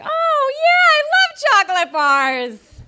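very high delighted gush; thrilled, over the top; excited squeal. Retold or imitated.

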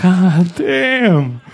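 loud, high "whoa" reaction; impressed disbelief. Retold or imitated. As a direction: exclaim it like you're floored. Reacts to cellmate's shocking story.

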